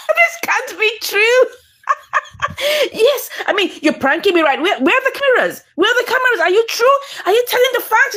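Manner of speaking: high-pitched, hurried disbelief; incredulous. Retold or imitated. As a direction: act out stunned disbelief, voice riding high. Acted disbelief at a love confession.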